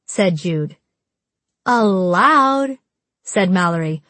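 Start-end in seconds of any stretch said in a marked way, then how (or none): 1.7-2.8 drawn-out, rising incredulous challenge; indignant echo. A narrator's reading. Mallory challenging Jude's ban, indignant drawn-out question. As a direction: stretch the word, pitched up in affront.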